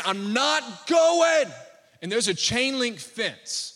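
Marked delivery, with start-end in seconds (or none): 0.0-1.5 higher, louder, very slow mock child yell; kid yelling. Pastor imitating the boy refusing at the fence, yelling. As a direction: drag out the refusal like a stubborn kid. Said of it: "This kid is saying".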